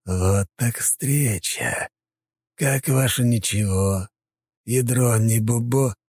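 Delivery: slow, oily mocking sweetness; unctuous. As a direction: drip with fake sweetness. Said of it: "голос стал более елейным".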